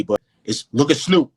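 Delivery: usual speaking voice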